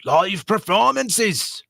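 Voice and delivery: hammed-up Scottish announcer; mock Scottish. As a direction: read the flyer in a broad put-on Scottish voice. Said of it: "There you go".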